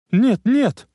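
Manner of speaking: high, loud panicked "нет, нет"; frightened cry. A narrator's reading. Clara, seeing the gun at Emmett, cries out in alarm. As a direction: cry out in alarm to stop him. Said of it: "воскликнула она".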